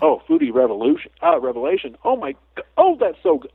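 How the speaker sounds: high, gushing foodie rapture; delighted. Retold or imitated. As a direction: swoon over the best bite ever. Owl relishing a fresh mouse.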